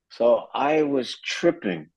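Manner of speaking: ordinary talk, nothing marked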